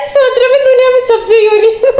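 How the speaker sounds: mock him while you can't stop laughing; laughing-crying voice. Real, not acted. Mocking brother while laughing hard.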